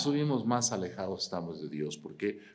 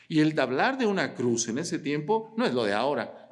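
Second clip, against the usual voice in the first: normal talking voice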